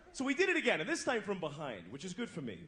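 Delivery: normal talking voice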